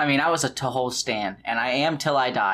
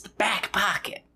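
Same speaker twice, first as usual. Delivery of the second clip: imitating cool cat voice; 5 semitones higher, 4 dB quieter than usual. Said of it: "That vocal raise".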